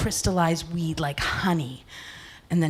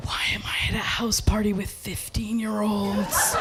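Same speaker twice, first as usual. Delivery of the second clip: hushed, softer self-questioning; appalled whisper; 5 dB quieter than usual. Acted. Woman looks round at the teenage party, appalled whisper. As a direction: whisper it as the realisation sinks in. Looks around, realises where she is.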